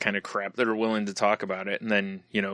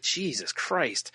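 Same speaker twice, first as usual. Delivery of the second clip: exasperated; 5 semitones higher, 6 dB quieter, much slower than usual. Retold or imitated. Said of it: "I was like".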